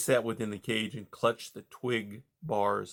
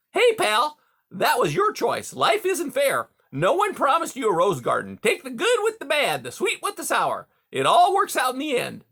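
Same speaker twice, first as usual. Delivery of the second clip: much higher, louder hearty voice; hearty beaver; 14 semitones higher, 6 dB louder than usual. A narrator's reading. Beaver Mister Conrad greeting caged Rye, high and hearty. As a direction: boom out a jovial salesman beaver.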